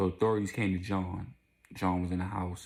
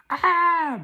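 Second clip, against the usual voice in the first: loud, high-pitched scream of horror; sudden piercing mock shriek; imitated scream; 12 dB louder than usual. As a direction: scream out at the shock.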